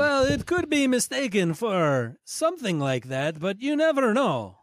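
put-on high, warbled Transylvanian accent with French touches; warbling Dracula-French accent. Professor character muses on his accent, warbled Transylvanian-French. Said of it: "It just warbled".

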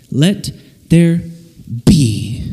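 "Let there be", word by word, with a popped B on "be"; pause, then drop low and burst "be" out on a puff of air; plosive demonstration. Shown as a demonstration. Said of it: "say these three words with me".

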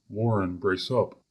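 plain reading voice

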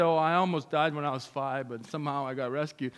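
acting out someone playing it down; minimizing. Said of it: "then people minimize it".

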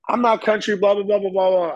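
put-on high Southern teen protest, trailing into blahs; exaggerated high-pitched Southern imitation; thick Southern accent. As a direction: mimic a country girl denying it in a Southern accent. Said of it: "in her just deepest, thickest Southern accent".

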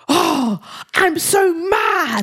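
put-on high, drawn-out little-kid rage; hammed-up tantrum; mock child's fury. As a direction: imitate a furious child, stretching every word slowly. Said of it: "he was like".

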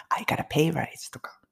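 soft whisper of a sample boast; whispered example. Shown as a demonstration. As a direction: whisper it like a small secret.

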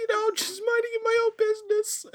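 squeaky high coy cartoon fox; mock Tails voice. Friends riffing Sonic fanfic, high coy Tails voice. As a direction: play it up as a coy cartoon sidekick. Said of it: "Peter would just go like".

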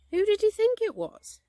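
high, loud puzzled query; baffled question. A narrator's reading. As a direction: ask in surprised protest. Pooh baffled at Rabbit's doubt.

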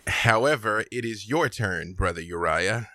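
ordinary delivery

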